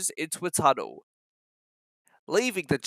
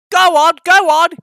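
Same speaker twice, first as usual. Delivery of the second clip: much higher, much louder eager puffing; puffing engine; 12 semitones higher, 12 dB louder than usual. Acted. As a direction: urge Edward on in a hurry, puffing. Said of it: "he puffed to Edward".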